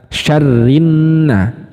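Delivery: very slow syarrinna with doubled r and n; slow recitation demo; merged lam. Shown as a demonstration. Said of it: "lam sukunnya itu dileburkan".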